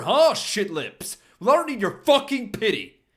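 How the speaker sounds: high, loud, spitting rage; furious shout. Acted. Max yelling at Paul and Richie, furious and contemptuous. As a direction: tear into them with scorn.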